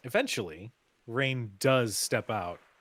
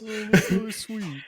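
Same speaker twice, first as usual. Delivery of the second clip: high, louder laughing coo; laughing mock coo; 12 semitones higher, 10 dB louder than usual. GM mimics crew cooing at the couple, laughing high. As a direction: coo sweetly while laughing.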